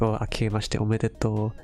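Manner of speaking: usual speaking voice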